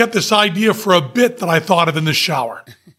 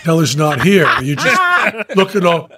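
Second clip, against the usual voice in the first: ordinary talk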